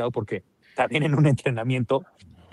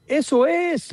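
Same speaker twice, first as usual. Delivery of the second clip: grito de aliento; 12 semitones higher, much slower than usual. Acted. Imita los gritos de entrenamiento.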